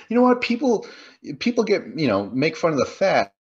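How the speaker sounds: mock the offended girlfriend making her point; imitated indignation. Girlfriend taking offense at a movie title, imitated indignation. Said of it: "she would always take offense to that".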